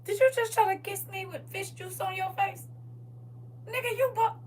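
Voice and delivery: disgusted partner. Pretend. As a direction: recoil from the kiss, lightly voiced. Acted disgust at cheater's kiss.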